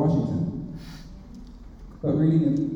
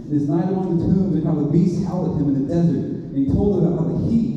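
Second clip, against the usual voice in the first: ordinary talk, nothing marked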